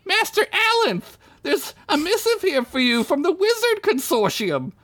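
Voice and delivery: put-on very high squeaky boy, winded; out-of-breath boy. Winded servant boy delivering a letter to his master. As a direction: gasp it out in a high kid voice. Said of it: "out of breath".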